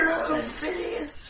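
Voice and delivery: high-pitched, drawn-out Scottish voice; Scottish accent. Acted. GM repeating Garin's info dump, Scottish accent. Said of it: "info dump in his Scottish accent".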